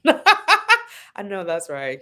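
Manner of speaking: laughing, slow agreement. Real, not acted. Guest agreeing with a paid bill wish, laughing.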